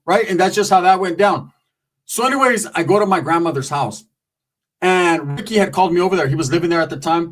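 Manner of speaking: usual speaking voice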